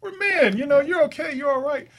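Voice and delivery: high, loud, hearty dad voice; reassuring dad. Retold or imitated. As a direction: boom out comfort like a proud father. Dad reassures son, retold.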